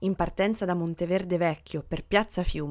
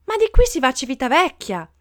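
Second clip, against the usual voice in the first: loud, higher-pitched objection; stormy protest; 5 semitones higher, 8 dB louder than usual. A narrator's reading. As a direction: protest indignantly like an upset passenger. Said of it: "le proteste diventarono un coro tempestoso".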